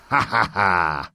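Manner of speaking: mocking laugh. A narrator's reading. Viking mocking his captors.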